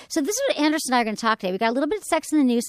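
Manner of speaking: rapid-fire run through the rundown; talking fast. Real, not acted. Host rushing listeners through tonight's topics, talking fast. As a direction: rattle it off quickly. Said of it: "you talked so fast".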